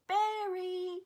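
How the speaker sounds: high mock baby yell; imitating screaming toddler. Baby boy at the window after the dog, mock screaming. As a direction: yell it like a tiny kid, high and drawn out. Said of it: "screaming it".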